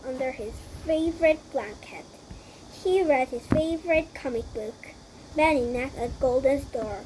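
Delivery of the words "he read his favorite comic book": plain reading voice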